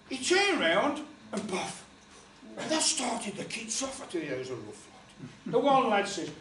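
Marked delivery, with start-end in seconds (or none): none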